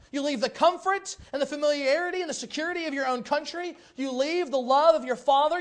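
loud, high sermon delivery; full-force preacher voice; fervent preaching. Acted. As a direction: press the congregation with questions.

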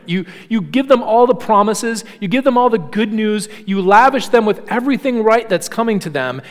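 normal talking voice

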